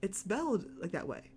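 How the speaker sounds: ordinary talk, nothing marked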